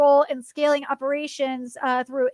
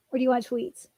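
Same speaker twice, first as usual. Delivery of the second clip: ordinary talk, nothing marked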